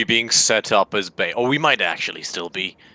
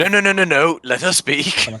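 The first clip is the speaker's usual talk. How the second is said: higher-pitched, hurried interruption; urgent interjection; 7 semitones higher than usual. Acted. Player stops the dwarf so she can speak, urgent interjection. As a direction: cut in eagerly, pitched up.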